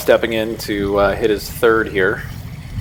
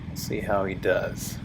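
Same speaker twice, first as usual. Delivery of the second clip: golf commentator; 2 semitones lower, 10 dB quieter than usual. Acted.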